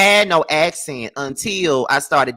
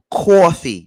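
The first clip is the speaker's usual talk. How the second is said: put-on New York accent; 5 dB louder than usual. Imitating how New Yorkers say coffee, exaggerated New York accent. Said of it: "I could hear that little New York, uh, accent so hard".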